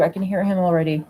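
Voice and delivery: ordinary talk, nothing marked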